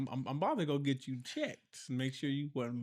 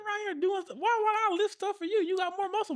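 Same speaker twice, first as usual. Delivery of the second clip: squeaky mocking voice; faster than usual. Husband mocks, squeaky voice.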